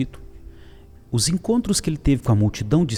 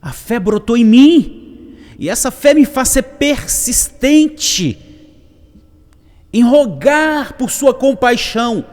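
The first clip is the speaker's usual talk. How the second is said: high, slow, full-force cry; shouting beggar; 10 dB louder, slower than usual. Acted. Blind beggar declares his faith to Jesus, shouting. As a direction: shout it slowly, stretching the key words. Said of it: "como se ele estivesse gritando assim".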